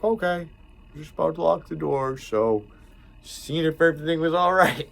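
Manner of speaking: high, loud mock coworker voice; imitating Ryan. Coworker Ryan checking everything's fine, high imitation. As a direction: play the cautious kid checking on him, pitched high. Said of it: "He's like".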